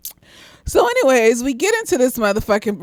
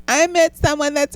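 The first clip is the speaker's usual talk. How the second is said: put-on gushing teen girl; 6 semitones higher than usual. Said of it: "telling all my friends".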